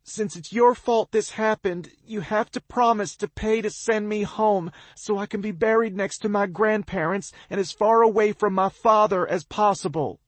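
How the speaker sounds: high, loud, pained demand; thick with emotion. A narrator's reading. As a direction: push through pain and fear. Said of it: "her voice is thick with emotion and pain".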